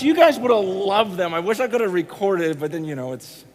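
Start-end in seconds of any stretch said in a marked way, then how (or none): none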